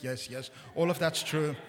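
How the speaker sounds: ordinary delivery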